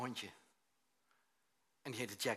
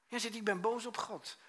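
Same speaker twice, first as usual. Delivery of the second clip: high, louder protest of being angry; mock angry declaration; 10 semitones higher, 7 dB louder than usual. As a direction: voice the aggrieved man, pitched high. Man's angry statement, acted. (verontwaardigde uitroep naspelen)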